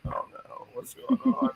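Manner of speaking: retelling his own freak-out in a high, soft squeak; mock panic. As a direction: play up the panic in a tiny high voice. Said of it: "I'm like".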